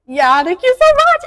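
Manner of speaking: high, loud squealed thanks; gushing. Retold or imitated. Daughter to mom in the car, gushing. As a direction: gush it out in delight.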